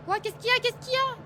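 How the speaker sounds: sudden high alarmed cry; startled. Real, not acted. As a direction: jump at the noise and shout. Startled by oven noise in game.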